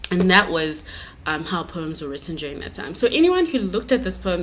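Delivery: ordinary talk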